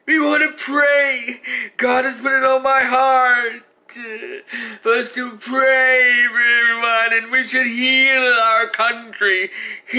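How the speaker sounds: hammed-up tearful sanctimonious voice; mock pious mother. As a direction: ham up a teary plea for healing. Mocking the mother's prayer speech.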